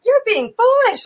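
high, loud retold scolding; emphatic rebuke. Woman rebukes the Satanist to his face, emphatic and high.

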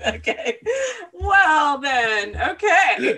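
bursting out laughing. Real, not acted. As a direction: breaks into loud laughter. Reacts to partner's cheeky joke.

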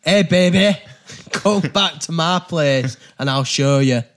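loud, badly faked Mancunian come-on; hammed-up attempt at Manchester; bad Manchester accent. Player trying Manchester on a card for friends, bad Manchester accent. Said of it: "That's the worst Manchester accent I've ever heard".

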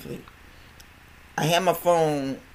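ordinary talk

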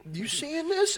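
high, disbelieving squeak; amazed. Retold or imitated. To Naj watching the fight, high amazed disbelief. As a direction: pitch it way up in disbelief. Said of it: "I was like".

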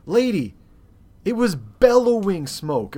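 loud, high, exasperated protest; retold indignation. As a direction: stretch the words out in exasperated disbelief. Retelling indignant reply to support agent.

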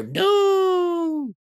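put-on high childish cry of no; kids protesting. Children shouting no at the puppet play. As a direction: wail it like upset little kids. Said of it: "And the kids go".

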